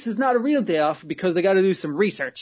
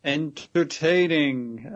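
usual speaking voice

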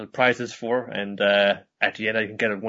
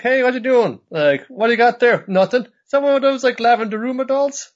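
high, loud teasing cartoon voice; pry at them in a squeaky cartoon voice; mock nosy friend; 13 semitones higher, 5 dB louder than usual. The gang teasing them about the doll, high and loud.

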